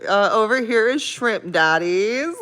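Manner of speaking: mock tour guide pointing at the camera. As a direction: present the restaurant like a proud guide. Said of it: "I'm like".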